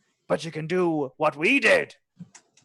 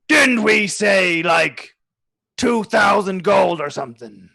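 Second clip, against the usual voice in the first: loud; 4 semitones higher, 7 dB louder than usual. Acted. GM voices suspicious NPC.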